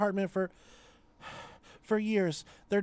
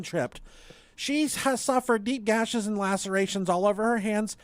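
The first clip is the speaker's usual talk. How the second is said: mock sincere reading; 7 semitones higher than usual. Reading the GoFundMe plea aloud.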